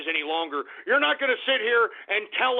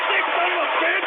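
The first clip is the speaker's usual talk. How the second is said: fast, higher-pitched outraged yell; angry yell; 8 semitones higher, 3 dB louder, faster than usual. Acted. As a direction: spit it out in disgust. Host enraged by chat shout-outs.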